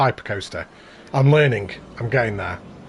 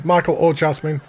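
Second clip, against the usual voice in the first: normal talking voice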